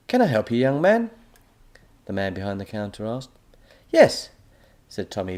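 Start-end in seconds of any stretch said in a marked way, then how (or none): 0.1-1.1 shopkeeper voice. A narrator's reading. Counter man asks. 3.9-4.3 put-on boy voice. A narrator's reading. Tommy orders.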